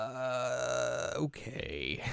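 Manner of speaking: soft, drawn-out grudging okay; reluctant agreement. Retold or imitated. Acts Yohane reluctantly agreeing.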